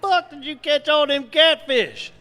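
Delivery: high cartoon Cajun voices; swapping squeaky characters; Boudreaux and Thibodeau. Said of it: "Boudreaux looks at him and goes".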